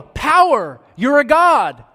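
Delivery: loud, high cry of wonder; a crowd's loud, high cry of awe; awestruck crowd. Retold or imitated. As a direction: shout it like a crowd that has seen a miracle. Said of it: "The people see it and they go".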